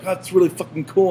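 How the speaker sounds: high-pitched mock enthusiasm; imitating impressed friends. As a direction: gush as the friends did. Retold friends' impressed reaction.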